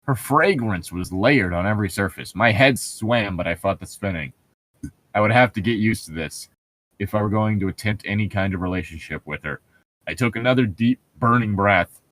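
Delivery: lightly hammed-up novel reading; story narration. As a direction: read it like a brooding romance novel. Reads novel passage aloud.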